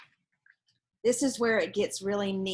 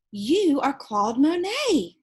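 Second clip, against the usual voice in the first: slow, raised amazed realization; gasped; 6 semitones higher, 7 dB louder, slower than usual. Acted. Julie realizing who the old man is, gasping. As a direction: breathe it out in wonder. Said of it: "she gasped".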